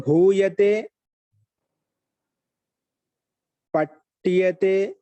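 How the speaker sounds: loud, stretched syllables with long gaps; slow dictation. Shown as a demonstration. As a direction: dictate each word slowly and loudly. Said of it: "స్లోగా చెప్పరా". (నెమ్మదిగా, గట్టిగా పలకడం)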